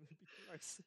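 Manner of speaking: ordinary delivery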